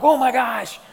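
high, loud panicked outburst; freaking out. Acted. Parent who just lost a child in the store, acted panic. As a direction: cry out in mounting alarm. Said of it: "you start freaking out".

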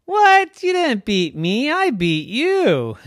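loud, high-pitched protest; indignant. Acted. Ferrari disputes who won the race, indignant. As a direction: squawk back in outrage, dragging the last words.

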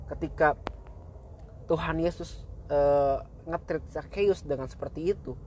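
ordinary delivery

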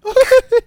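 sudden high laugh; loud laugh. Real, not acted. Laughs at cheque joke.

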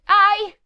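high, loud "ow!"; sudden cry as if bitten; yelp of pain. A narrator's reading. Brigand's wife bitten on the ear, yelping. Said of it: "esclamò in quello stesso momento".

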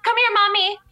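put-on high-pitched child summoning mommy; demanding little boy. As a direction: imitate a bossy small child. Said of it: "he'll just be like".

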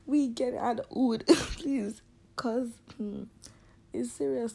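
put-on high, loud Yoruba accented plea. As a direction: beg God in a Yoruba accent. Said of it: "I use my Yoruba accent".

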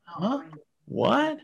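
high-pitched confused little questions; mock bewilderment. Passenger looking around at security guards, mock bewildered. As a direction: squeak in hammed-up confusion.

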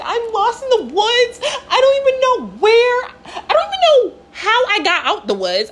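acted high-pitched distressed wail; panicked. Lost hiker calling her sister, high and panicked.

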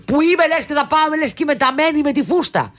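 high, gloating told-you-so; mocking triumph. Daughter gloating to mum that everyone wore overalls.

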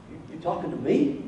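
hushed, doubtful question to himself; incredulous thought. Retold or imitated. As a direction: quietly check he really means you. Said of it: "And Gideon probably thought".

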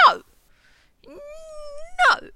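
high, loud theatrical wail; mock dramatic no. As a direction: wail it like a diva. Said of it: "I'll say".

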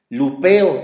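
"Lupeo" said as lu-PE-o, the E stressed and loud. Shown as a demonstration. Preacher teaching the Greek word to the congregation, stressing the E. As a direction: lay the weight on the E of "Lupeo". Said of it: "lupeo con acento en la E".

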